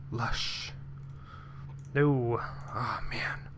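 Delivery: breathy letdown under the breath; whispered sigh of disappointment at the planet; disappointed whisper. Real, not acted. Gamer whispering in disappointment at another dry planet. Muttering to self over planets.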